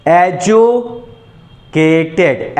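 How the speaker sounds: एजुकेटेड very slowly, pause after एजु, then केटेड; broken into syllables. Shown as a demonstration. Teacher demonstrating a word break to students, slow and split. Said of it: "दो बार में break हो रहा है ना".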